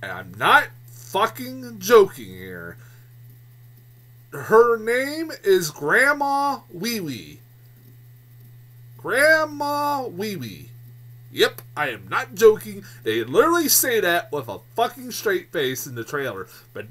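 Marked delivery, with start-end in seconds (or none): 4.3-10.7 Grandma Wee Wee drawn out slowly, higher, each word hit; slow incredulous emphasis. Real, not acted. Reviewer telling viewers the grandmother's name, slow disbelieving emphasis. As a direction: spell out the silly name in disbelief, slow and deliberate.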